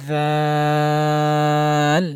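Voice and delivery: tongue tip to teeth, hold "জাল" long without puffing air; thin letter, elongated. Shown as a demonstration. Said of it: "এটা পাতলা হরফ". ("জাল" পাতলা করে লম্বা টানে)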